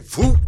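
high, loud mock trip noise; trip sound effect. Retelling a tuba player tripping a kid, high loud phoop. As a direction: pop it out like a foot shooting out. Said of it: "the tuba player at the end, who fucking was like".

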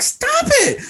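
squeaky high, mock-alarmed; high mock startled protest. Squeaky high protest. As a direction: play your own startled yelp at the friend hitting you.

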